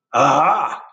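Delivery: hammed-up giant exclaiming aha; a touch of a pleased giant pouncing; mild mock giant delight; giant's aha. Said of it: "said the giant".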